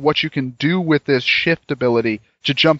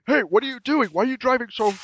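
alarmed passenger; 7 semitones higher, faster than usual. Acted. Acting alarmed car passenger.

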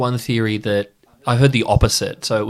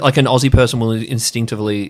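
ordinary delivery

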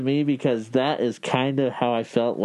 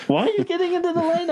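squeaky, much higher, fast mock panic; alarmed driving instructor; much faster than usual. Driving instructor yelping at student, squeaky high mock voice. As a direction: play the instructor freaking out at the wheel. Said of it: "he's like".

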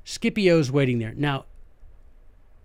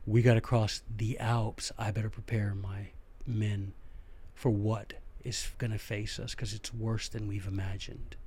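low resolve; 7 semitones lower, 11 dB quieter than usual. Retold or imitated. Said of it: "you'll say, "Okay".

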